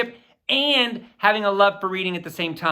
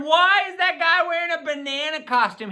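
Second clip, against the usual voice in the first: put-on playful, higher voice of a puzzled kid asking; 7 semitones higher than usual. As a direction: mimic a curious kid's question. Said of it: "you're probably wondering".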